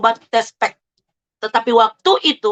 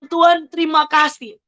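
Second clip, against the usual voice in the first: raised, high-pitched cry of thanks; retold shout; 6 semitones higher than usual. Retelling her thanks to God for the pregnancy, mild retold shout. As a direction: call out gratefully, mildly imitating a shout. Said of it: "saya berteriak, saya bilang".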